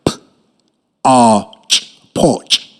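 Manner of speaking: "P-" then drawn-out "arch", blended into "porch"; sounding out. Shown as a demonstration. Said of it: "Listen what I sound out the word".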